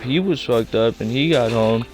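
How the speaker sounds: ordinary talk, nothing marked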